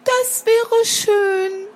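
high, slow, wistful little bird; sighing young sparrow. Acted. Sparrow child is tempted by the cat's offer, sighing. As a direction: say it slowly and high, with longing. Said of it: "seufzte das Spatzenkind".

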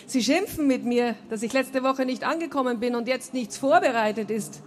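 ordinary delivery

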